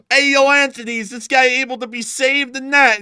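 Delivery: high, loud hammed-up yell; mock shout. Bystander shouting up to Anthony, high comic yell.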